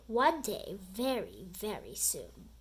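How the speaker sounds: a principal's threat dropping to a low, soft hush; menacing vow fading low. A narrator's reading. Principal vows revenge on the boys, sinking low and soft. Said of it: "Mister Krupp vowed".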